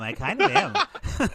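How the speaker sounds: high, slow, through a laugh; laughing admission. Real, not acted. Podcast host admitting whose side she takes, laughing. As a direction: draw it out sheepishly while laughing.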